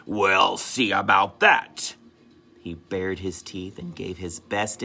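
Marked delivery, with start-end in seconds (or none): 0.1-1.9 slow, louder mock growl; growly threat. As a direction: menace the fox, playing lion. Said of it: "in a growly voice".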